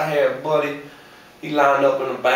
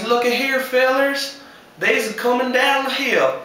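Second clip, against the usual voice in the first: Longstreet's call; 10 semitones higher than usual. Retold or imitated. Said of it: "Longstreet says".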